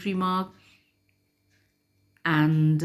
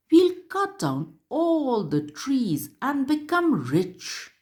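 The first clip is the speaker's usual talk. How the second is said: higher, mocking villain voice; wicked men gloating; 9 semitones higher than usual. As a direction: gloat like a greedy woodcutter.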